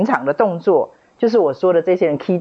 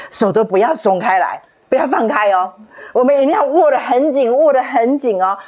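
越来越高越响的急切嘱咐; 紧抓着手急急叮咛; 紧张叮嘱; 7 semitones higher than usual. Retold or imitated. 紧握蛇哥的手叮嘱. (紧张地叮嘱蛇哥别放手)

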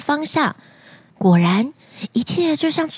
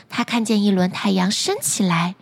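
ordinary delivery

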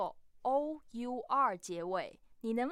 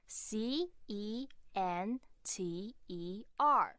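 slow spelling-out; much slower than usual. Shown as a demonstration. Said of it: "美式的拼法是什么啊".

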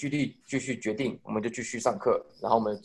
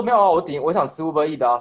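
a light, higher, louder careless excuse; imitating the roommate; 4 semitones higher, 5 dB louder than usual. Retelling the roommate's excuse for the card, mildly mimicked. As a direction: toss off the excuse like it's nothing. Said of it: "然后他说".

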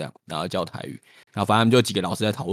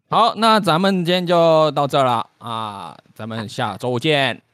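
slow, louder Beijing sign-off; Beijing accent; 4 semitones higher, 4 dB louder, slower than usual. Shown as a demonstration. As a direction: close the show Beijing style. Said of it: "那咱们用北京腔".

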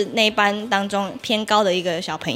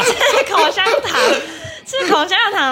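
laughing; 11 semitones higher than usual. Real, not acted. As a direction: giggle through the words. Laughing through the repeat. (笑着重复)

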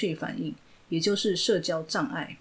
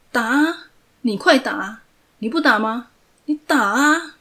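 goading yell; 5 semitones higher, 8 dB louder than usual. Acted. Villain head-butting, goading.

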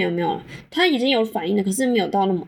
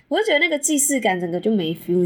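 normal talking voice

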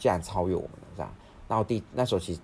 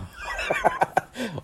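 high, sudden laugh that falls away; burst of laughter. Real, not acted. Laughing at his sister's blunt answer, high burst of laughter.

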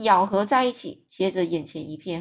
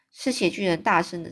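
ordinary delivery, nothing marked

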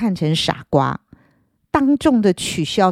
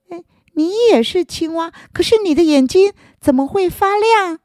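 high, eager little frog; 10 semitones higher than usual. Acted. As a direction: ask like a curious small frog.

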